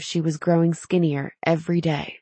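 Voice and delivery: ordinary delivery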